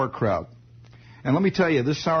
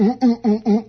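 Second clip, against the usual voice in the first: loud, higher rhythmic oohs; rhythmic ooh noises; 8 semitones higher, 6 dB louder than usual. Shown as a demonstration. Showing listeners how to push an Irish mail, rhythmic oohs. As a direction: ooh in repeated pumping bursts.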